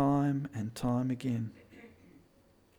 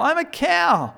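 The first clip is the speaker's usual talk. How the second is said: much higher, playful cow voice; cow's answer; 13 semitones higher, 6 dB louder than usual. Acted. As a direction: answer like a silly storybook cow.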